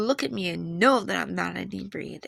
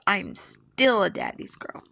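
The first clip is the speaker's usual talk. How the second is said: ordinary delivery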